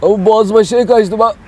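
short of breath, choking; gasping between words; hyperventilating. Real, not acted. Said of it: "nefes alamıyorum".

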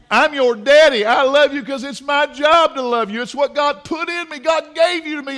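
father's love preached high and loud; impassioned preaching. Real, not acted.

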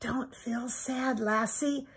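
put-on a boy whispering comfort; whispered comfort. A narrator's reading. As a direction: soothe the sad dog in a hush. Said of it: "Timmy whispered".

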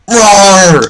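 loud, high-pitched growling roar; frustrated roar. Real, not acted. Gamer killed again and again, frustrated roar. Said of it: "it's just so frustrating".

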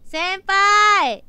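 calling out to the departing senpai, pleading. Real, not acted. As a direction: beg him not to go home. Pleading senpai to come back.

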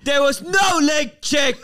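slow, loud, high outraged yell; outraged shout. Retold or imitated. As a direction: bellow it slowly like a furious pundit. Acted outraged pundit quote.

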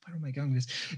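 whispered to himself; a quick whisper under his breath; whispered aside. Real, not acted. The artist losing his thread, whispering to himself.

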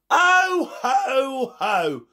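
high ho-ho fading down; amused laugh at the boys; mock chuckle. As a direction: chuckle knowingly, tapering off.

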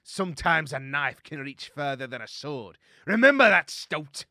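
loud warning rising to a shout; defiant shout. Acted. Captive warrior warns the pirate after throwing the dagger, shouting defiantly. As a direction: hurl it at your enemy.

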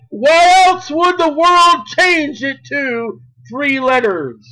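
slow, high, emphatic proclamation; full-force preaching. Real, not acted. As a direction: proclaim each phrase with drawn-out force.

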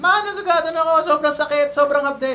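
put-on high, whining little-kid voice; whiny kid. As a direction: complain like a hurt child. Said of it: "I was like".

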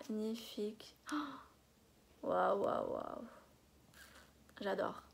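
hushed, breathless waouh; awed whisper. Real, not acted. Marvelling at a stamp sheet for her viewers, awed whisper. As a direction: whisper it in awe.

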